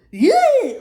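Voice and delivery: very high, very loud yeah; imitated lady's yell. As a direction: blast out a mock startled holler. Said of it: "she literally went".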